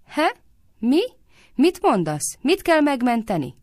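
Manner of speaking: high, loud, uncomprehending questions; dim-witted bewilderment. A narrator's reading. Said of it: "egy kicsit nehéz felfogású, vagy tám megsüketült".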